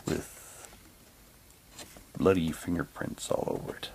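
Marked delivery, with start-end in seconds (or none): none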